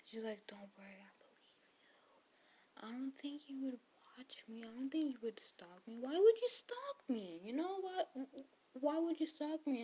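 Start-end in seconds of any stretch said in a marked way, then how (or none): 0.5-6.0 mock whisper. Retelling her reassuring him. 6.0-9.8 mock disbelief. Retelling her disbelieving outburst.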